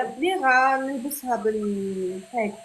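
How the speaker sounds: normal talking voice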